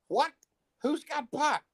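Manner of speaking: high, startled Who's got pot; retold shock. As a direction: act stunned at the news, voice jumping up. Shocked reaction to pot news.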